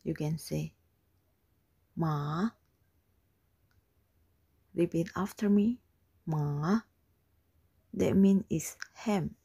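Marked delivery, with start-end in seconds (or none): 1.9-2.5 "ma" from low, gliding upward; rising tone. Shown as a demonstration. Teacher shows what you can say, "ma" rising. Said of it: "The fourth tone is the rising tone". 6.3-6.8 "Ma" begun low and lifted at the end; rising tone. Shown as a demonstration. Teacher has class repeat "Ma", rising tone. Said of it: "The fourth tone is the rising tone".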